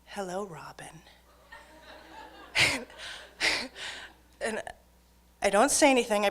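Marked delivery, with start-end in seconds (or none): 0.0-1.0 soft, menacing whispered greeting; quietly menacing; creepy hushed hello. Retold or imitated. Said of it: "then she says". 1.9-4.1 breathy nervous laugh; a short embarrassed laugh. Real, not acted. Nervous reaction.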